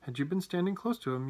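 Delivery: plain reading voice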